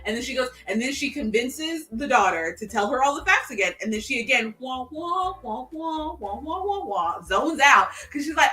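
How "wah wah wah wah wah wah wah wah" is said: higher, softer wah-wah like adults in a cartoon; muffled wah wah talk. Mom zoning out while the daughter talks, soft wah-wah babble. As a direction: blur the daughter's words into trumpet-like wahs.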